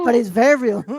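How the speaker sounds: hammy politician's stump-speech impression; Donald Trump. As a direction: boast in short repeated phrases, like a campaign rally. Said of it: "say this in the Trump voice".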